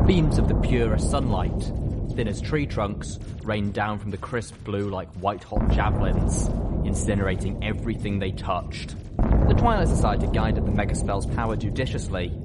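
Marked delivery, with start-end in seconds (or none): none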